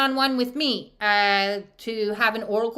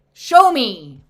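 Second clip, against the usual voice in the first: loud emphatic; 12 dB louder than usual. Shown as a demonstration. Repeated show me, loud.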